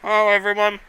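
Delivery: talking through a mouthful of ice cream; mouth full. Real, not acted. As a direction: speak around a cold bite. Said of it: "I got ice cream in my mouth".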